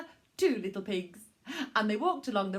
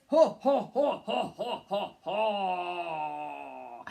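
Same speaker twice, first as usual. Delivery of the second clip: wolf laugh; 4 semitones lower, much slower than usual. Acted.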